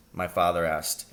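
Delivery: ordinary delivery, nothing marked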